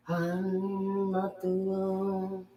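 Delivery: soft, very slow held words; drawn-out refusal. Acted. Answering who gets the stunner, soft drawn-out refusal. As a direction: stretch it out quietly.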